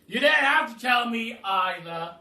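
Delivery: loud booming cry; Duke crying out. Acted. The Duke cuts off the giraffe with a booming cry. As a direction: call it out with gusto. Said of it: "cried the Duke".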